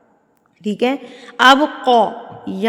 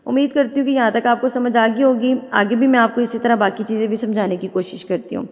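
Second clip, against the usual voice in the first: ordinary talk, nothing marked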